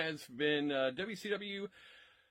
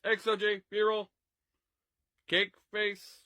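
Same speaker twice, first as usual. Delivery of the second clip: ordinary talk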